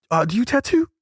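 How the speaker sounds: high mock-curious question; imitating the organizer.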